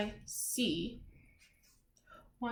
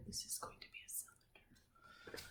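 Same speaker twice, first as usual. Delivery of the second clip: whisper; 21 dB quieter than usual. Acted. Teacher secret aside while drawing.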